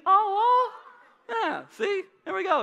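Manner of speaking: high, cooing oh and praise; mock coo with crowd. Shown as a demonstration. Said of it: "You guys wanna do that with me".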